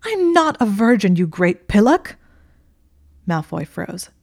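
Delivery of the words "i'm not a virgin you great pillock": "I'm not a virgin" yelled high and much louder; exasperated shout. Acted. Hermione correcting Draco, exasperated yell. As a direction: yell it right in his face.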